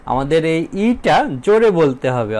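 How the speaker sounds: normal talking voice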